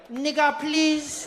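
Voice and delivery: mock exasperated cousin; pleading cousin. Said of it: "my cousin would be there like".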